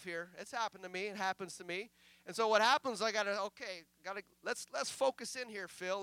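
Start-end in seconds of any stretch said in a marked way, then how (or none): none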